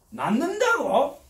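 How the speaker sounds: patient jumping up to challenge the preacher, slow and doubting; challenging disbelief. Retold or imitated. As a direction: drag it out as a dare. Man leaps up, challenging.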